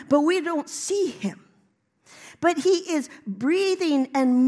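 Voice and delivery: impassioned preaching, key words punched loud; preaching at full force. Real, not acted. Preacher proclaiming Holy Spirit to the church, impassioned preaching. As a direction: drive each phrase home with swelling emphasis.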